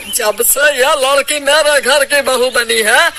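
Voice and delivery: ordinary delivery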